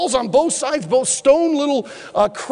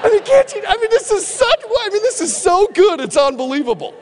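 ordinary delivery

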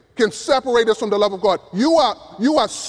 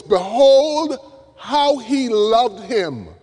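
Behold rings out high and loud, then slows right down on how he loved him; awed exclamation; 4 semitones higher, 3 dB louder, much slower than usual. Acted. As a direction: marvel out loud at a great love.

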